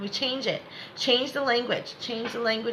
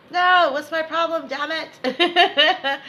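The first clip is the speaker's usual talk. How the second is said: frustrated whiny voice; 7 semitones higher, 7 dB louder, much slower than usual. Retold or imitated. Mocking client's frustrated inner voice.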